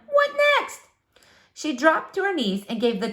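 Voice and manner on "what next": much higher, fluttery exasperation; fretful woman. Acted. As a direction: squeak it out in dismay. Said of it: "said Missus Tickham".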